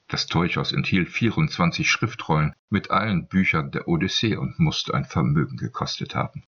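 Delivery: ordinary delivery, nothing marked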